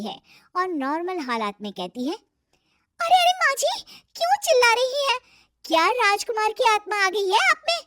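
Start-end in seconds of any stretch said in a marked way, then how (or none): 2.1-7.8 high, loud theatrical question; high melodramatic heroine voice; filmy dramatics. Acted. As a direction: ham it up like a film heroine. Dramatic filmy question to mother-in-law.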